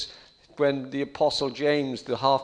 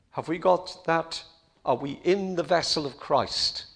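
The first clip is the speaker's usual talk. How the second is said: ordinary delivery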